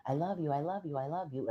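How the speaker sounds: high mock-sweet I love you, I love you; imitating her new boyfriend's gushing; mock declaration. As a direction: parody his gushing. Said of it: "And I knew it was weird".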